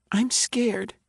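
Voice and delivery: put-on high, small child's voice; a frightened five-year-old admitting fear; scared little girl. A narrator's reading.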